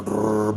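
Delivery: low rolled brrr; helicopter noise. Acted. Storyteller flying to Africa, imitated rotor noise. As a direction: trill it like spinning blades.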